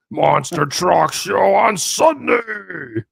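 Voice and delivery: booming announcer, high and loud; monster truck ad. Retold or imitated. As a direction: shout it like a cheesy truck rally commercial. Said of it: "Remember those stupid commercials".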